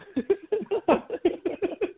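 high, sustained chuckle; laughing. Real, not acted. Amused by the fighter's retold boast, laughing. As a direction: laugh in high, quick bursts.

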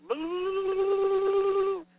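high loud spooky boo; scary boo. Pretend. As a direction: jump out and scare them. Scaring the viewer.

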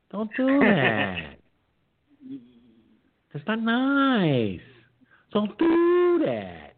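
high, slow, drawn-out pleading; mock whiny scolding. Real, not acted.

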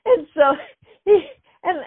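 high, loud excited cry. Real, not acted. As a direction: blurt it out thrilled. Excited, laughing.

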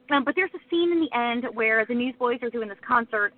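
ordinary talk

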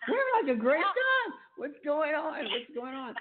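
very high, quick mock bewilderment; bewildered husband. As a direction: imitate a baffled husband, laughing through it. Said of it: "my husband looking at me like".

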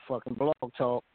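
usual speaking voice

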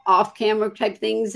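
usual speaking voice

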